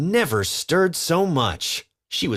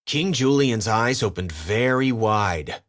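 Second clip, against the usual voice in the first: ordinary delivery, nothing marked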